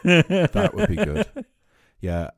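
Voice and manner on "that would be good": laughing. Real, not acted. Laughing approval.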